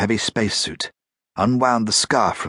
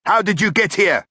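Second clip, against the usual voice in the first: high, loud demand; 12 semitones higher, 4 dB louder than usual. A narrator's reading. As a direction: demand it sharply. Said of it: "Vural demanded".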